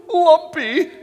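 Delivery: very high, very loud holler; yelled out. Retold or imitated. Somebody giving him his camp nickname, very high loud yell. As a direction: shout it at full force, high up. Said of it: "somebody would yell out".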